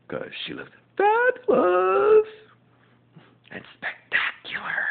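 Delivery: high slow swoon; gushing. Acted. Fan gushing about Grace's looks. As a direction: swoon over her looks.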